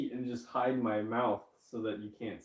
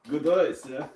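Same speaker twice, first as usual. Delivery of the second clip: loud, higher, badly faked ventriloquist's dummy voice; terrible ventriloquism; 4 semitones higher, 11 dB louder, slower than usual. Streamer answers as the dragon on the shoulder, terrible ventriloquism. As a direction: throw out a clumsy dragon-puppet voice through a barely moving mouth. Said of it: "doing some really terrible ventriloquism".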